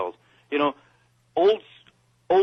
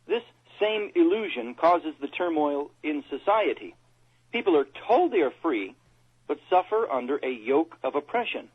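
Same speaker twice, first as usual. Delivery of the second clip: measured, emphatic reading in short phrases; formal quote reading; 3 semitones higher than usual. Real, not acted. Co-host reads Montgomery's quote to listeners, formal quote reading. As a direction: read it out phrase by phrase, pitched up.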